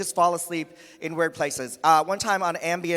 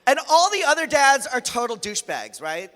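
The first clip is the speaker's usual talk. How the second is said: ordinary talk, nothing marked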